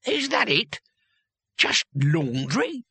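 put-on incredulous old warrior, pitch leaping up; drawn-out, outraged disbelief without shouting; understated rage. A narrator's reading. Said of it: "He was shaking with rage".

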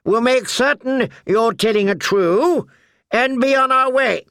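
a lord sure of himself; haughty knight. A narrator's reading. Sir Amory speaks.

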